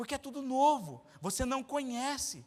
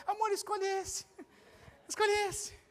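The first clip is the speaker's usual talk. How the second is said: high, teasing wife voice; wife's voice; 8 semitones higher than usual. Retold or imitated. Preacher mimics his wife coaxing him to pick.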